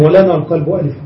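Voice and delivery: usual speaking voice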